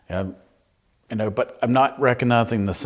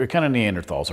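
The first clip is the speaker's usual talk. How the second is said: ordinary talk, nothing marked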